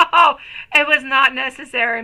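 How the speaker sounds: touched exclamation. Real, not acted. Thanking a viewer for a gift.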